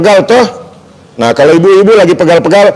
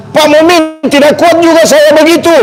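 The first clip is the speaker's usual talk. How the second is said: higher, pleading voice of a weak viewer; 7 semitones higher than usual. Pretend. Viewer begs Pak Mumin, too weak to press, higher pleading. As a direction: whine like a viewer with weak thumbs.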